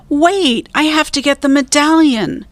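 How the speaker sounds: high urgent shout. A narrator's reading. Jack shouts to Annie over the storm. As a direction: call back over the rain. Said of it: "shouted Jack".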